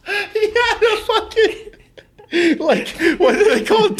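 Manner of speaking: high, wheezy real laughing fit; talking through laughter. Real, not acted. Telling friend what sausage Reed had, talking through laughter. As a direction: squeeze the words out between laughs. Still cracking up mid-story.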